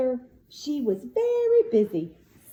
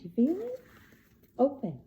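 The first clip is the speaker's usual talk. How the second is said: plain reading voice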